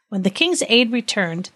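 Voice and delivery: ordinary delivery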